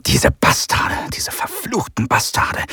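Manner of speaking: hissed bitter whisper; venomous whispered swearing; whispered curse. Acted. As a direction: curse the enemy under your breath. Soldier curses in shock.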